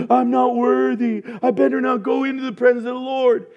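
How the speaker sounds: high, wailing self-reproach, hammed up; mock unworthiness. Preacher mimicking a sinner afraid to pray, mock-woeful. Mimics a guilt-ridden believer shrinking back.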